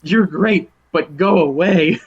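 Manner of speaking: teasing dismissal of an admirer; playful brush-off. Retold or imitated. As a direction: shoo him away fondly. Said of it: "for her to be like".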